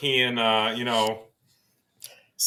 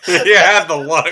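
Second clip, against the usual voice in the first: laughing, slow, louder; 4 semitones higher, 11 dB louder than usual. Real, not acted. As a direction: say it through laughter. Laughing at a friend's reaction.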